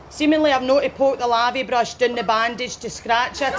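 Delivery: ordinary talk, nothing marked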